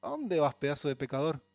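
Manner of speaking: loud, high mocking accuser, pushing hard; devil's taunting voice. Pastor voicing the devil to his listeners, loud high mocking taunt. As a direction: sneer and accuse like the devil himself. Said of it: "el diablo te dice".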